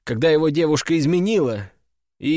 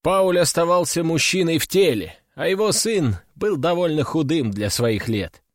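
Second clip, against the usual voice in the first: plain reading voice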